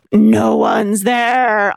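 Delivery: very slow demonic growl; demonic raspy voice. Retold or imitated. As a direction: rasp it out like a demon on the line. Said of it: "some demonic, raspy voice".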